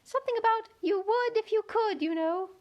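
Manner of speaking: very high, small gnat voice; very small voice at her ear; tiny voice. A narrator's reading. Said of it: "the little voice close to her ear".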